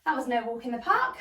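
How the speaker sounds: ordinary talk, nothing marked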